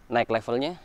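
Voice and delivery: usual speaking voice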